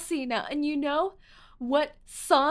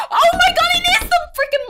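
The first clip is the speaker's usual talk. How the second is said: much higher, much louder, fast shout after a gasp; yelling; 12 dB louder, faster than usual. Real, not acted. Streamer spotting the moth again, panicked real shout. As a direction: shriek it out in sudden panic. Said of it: "I can't start yelling again".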